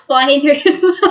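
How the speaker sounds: words through laughter; laughing. Real, not acted. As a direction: giggle the line out. Laughing at partner's death.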